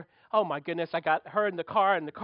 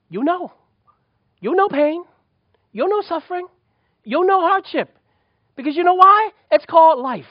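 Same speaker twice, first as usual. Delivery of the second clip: higher and louder preaching build; preaching at full force; 6 semitones higher, 8 dB louder than usual. Acted. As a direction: drive it home to the congregation. Preaching climax.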